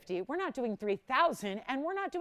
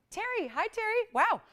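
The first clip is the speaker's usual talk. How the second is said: much higher, delighted hello; excited greeting; 10 semitones higher than usual. Real, not acted. Host greeting caller Terry, excited and high.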